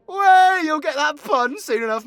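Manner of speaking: high, loud burst easing down; loud teasing. Acted. Storyteller teases listeners about a pun, loud outburst. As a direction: burst out loudly, then settle. Breaks out mid-tale to tease.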